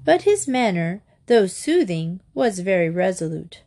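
plain reading voice

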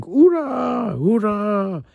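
high, joyful hurrah; lightly voiced crowd cheer. A narrator's reading. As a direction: cheer it like a happy crew. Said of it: "they started laughing and clapping and screaming".

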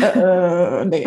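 verdutzte, dümmliche Hundestimme; silly dumb pet voice. Retold or imitated. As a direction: als begriffsstutziger Hund ablehnen. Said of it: "wenn der Hund sagt". (alberne Haustierstimme)

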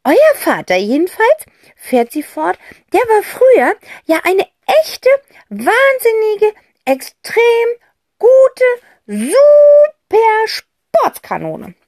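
a bubbly aunt raving about the father; lively aunt. Acted. Aunt Rotz voiced, high. (Tante Rotz, höher und schwärmerisch)

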